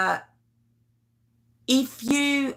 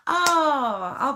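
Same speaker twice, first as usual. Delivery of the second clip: ordinary talk, nothing marked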